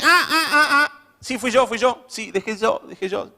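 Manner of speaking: high-pitched, hammed-up admission of a slip; humble owning up. Someone owning up to an error, high hammed-up confession. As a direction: blurt out the confession, high and eager. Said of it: "humilde, que reconoce su punto de error".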